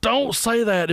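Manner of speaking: high-pitched mock alarm; panicked plea. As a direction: beg him not to say it. Silent panicked plea to drummer.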